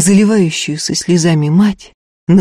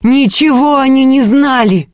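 high, urgent denial; frantic raised-pitch outburst; desperate hoarse cry; 8 semitones higher, 4 dB louder than usual. A narrator's reading. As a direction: cry out desperately to clear them. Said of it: "отчаянно, хрипло выкрикнула Устья".

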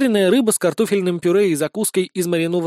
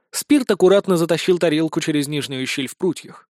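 plain reading voice

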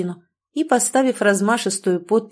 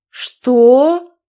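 sharp, loud, high startled "what"; surprised exclaim; 5 semitones higher, 9 dB louder than usual. A narrator's reading. Groom reacts in shock to the breakup, loud surprised cry. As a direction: blurt it out in shock. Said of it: "удивлённо воскликнул мужчина".

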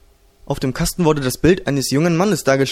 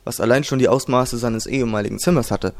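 ordinary delivery

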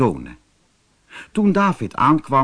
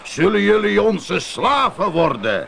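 giant calling out; 6 semitones higher than usual. A narrator's reading. Giant Goliath taunts the Israelite army, booming challenge. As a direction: bellow the challenge across the valley like a giant. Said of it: "en riep".

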